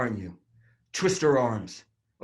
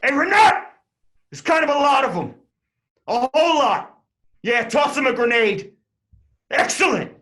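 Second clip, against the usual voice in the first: higher and louder barked commands; shouted orders; 10 semitones higher, 5 dB louder than usual. Acted. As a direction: yell orders across the street.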